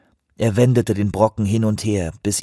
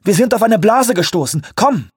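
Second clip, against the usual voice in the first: urgent; 11 semitones higher, 4 dB louder than usual. Acted. Urgent warning on deck.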